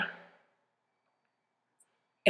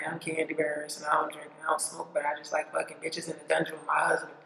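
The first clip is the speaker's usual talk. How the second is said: softer, hushed mock celebrity introduction; 2 semitones lower, 9 dB quieter than usual. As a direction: lightly imitate a prim star introducing herself, softer.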